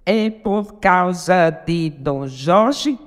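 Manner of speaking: very slow, syllable by syllable; contando sílabas. Shown as a demonstration. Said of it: "Mais sete". (escandindo devagar, sílaba por sílaba)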